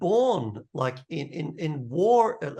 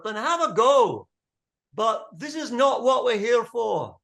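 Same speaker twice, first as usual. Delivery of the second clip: high, raised, fervent rallying voice; impassioned king's speech; 6 semitones higher, 4 dB louder than usual. Acted. Young king with the sword, sick of war, high impassioned appeal. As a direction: plead and proclaim to a hostile crowd. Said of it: "he sounds different when he's holding the sword".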